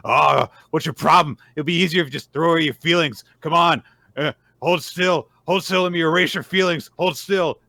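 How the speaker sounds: loud, high, pushy monster voice; creepy creature. Retold or imitated. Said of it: "stretching out the flesh really creepily and saying".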